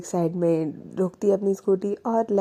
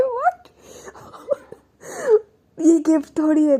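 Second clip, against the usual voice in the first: much higher, loud incredulous What; surprised; 8 semitones higher, 4 dB louder than usual. Retold or imitated. Vani startled that Prachi wants only momos, incredulous. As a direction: cry out in disbelief.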